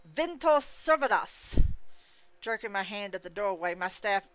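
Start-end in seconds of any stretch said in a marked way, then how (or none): 0.1-1.6 slow, high, booming spell cry; dramatic incantation. A narrator's reading. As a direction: call out the spell with full drama. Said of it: "in my best dramatic voice".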